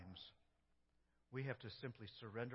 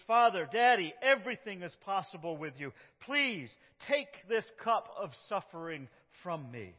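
loud, high anguished plea; Jesus pleading to be spared suffering; crying out; 6 semitones higher, 13 dB louder than usual. Acted. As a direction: beg the father, voice straining. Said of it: "Jesus is crying out".

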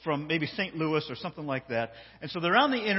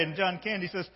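ordinary talk

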